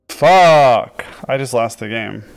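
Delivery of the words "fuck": sudden loud curse, higher, falling; real swear. Real, not acted. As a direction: blurt it out at the blunder. Just lost the game.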